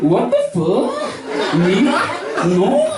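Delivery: high moaning mock voice. Comedian imitating local guys' moan, high and slow. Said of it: "they always got moans".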